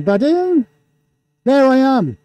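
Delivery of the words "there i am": high, delighted announcement; excited. Real, not acted. Host appears on overhead camera, excited. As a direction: pitch it up with excitement.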